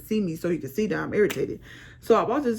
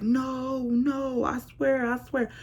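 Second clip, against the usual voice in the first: higher, lightly mocking his protest; pleading boyfriend; 6 semitones higher, much slower than usual. Girlfriend mimics her fiancé swearing he's innocent, higher and mocking. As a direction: imitate him swearing, a touch whiny.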